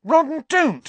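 high, loud, outraged cry; indignant yelp, voice shooting up; angry. A narrator's reading. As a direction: scold him in alarm. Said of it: "said Hermione angrily".

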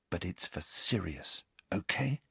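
whispering. A narrator's reading. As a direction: whisper it to the owl.

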